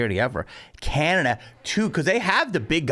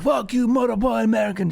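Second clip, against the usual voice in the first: put-on high-pitched angry heckler, botched Jamaican accent; weird Jamaican accent; 8 semitones higher than usual. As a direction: imitate his cursing in mock Jamaican. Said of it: "So he's like".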